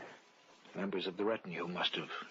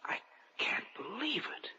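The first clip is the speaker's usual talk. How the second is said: breathless whisper of disbelief; hushed and unbelieving; awed whisper; 11 semitones higher, 3 dB quieter than usual. Acted. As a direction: whisper it, stunned. Stunned at sleeping corpse.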